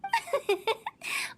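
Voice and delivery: high, mischievous giggle; gleeful laugh after the dark joke; playful laugh. Acted. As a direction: laugh off the teasing with a light giggle.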